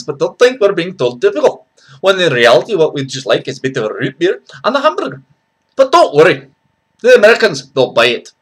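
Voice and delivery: loud, high-pitched Scots; Scottish accent. Shown as a demonstration. Scot rallying friends for a pint and haggis, loud Scottish accent. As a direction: call out heartily in a broad Scottish accent. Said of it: "and Scotland!".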